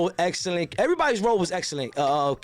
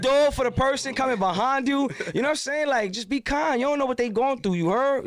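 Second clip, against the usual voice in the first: fired-up preaching; 6 semitones higher than usual. Real, not acted. Preaching kindness after tequila.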